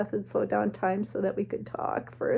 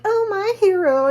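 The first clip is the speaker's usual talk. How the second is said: high, loud, gushing mock adoration; snarky, sing-out swoon; swooning damsel impression; 11 semitones higher, 7 dB louder than usual. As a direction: play the rescued damsel, hammed up. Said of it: "I did this little impression".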